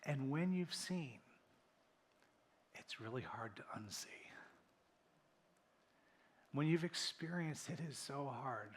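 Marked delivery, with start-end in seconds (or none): 2.8-4.1 hushed whisper. Real, not acted. Preacher to congregation, a hushed whisper. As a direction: drop to a whisper for weight.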